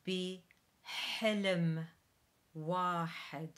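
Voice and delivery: slow Arabic repetition for learners; slow model. Shown as a demonstration. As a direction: drag each syllable out slowly. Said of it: "Take it slowly".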